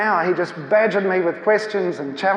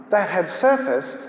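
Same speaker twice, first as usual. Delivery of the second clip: normal talking voice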